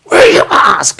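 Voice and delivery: high, loud yell across a shop; imitating an angry storekeeper's yell; yelled order. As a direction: bellow it at someone across the room. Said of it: "He just yells it out across the thing".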